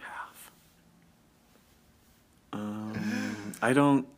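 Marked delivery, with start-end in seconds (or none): 0.0-0.5 whispered echo. Real, not acted. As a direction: whisper the word. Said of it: "echoing punchline".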